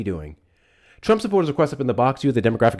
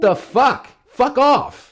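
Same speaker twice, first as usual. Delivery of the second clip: high, incredulous yell; disgusted shout; 10 semitones higher, 3 dB louder, much slower than usual. Real, not acted. Host dismissing the caller, shrill disgust. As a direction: spit it out in disbelief.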